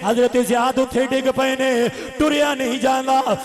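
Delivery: loud, ringing sermon chant; full-force preaching. A narrator's reading. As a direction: preach the battle story at full volume. Preaches the wounded man's return.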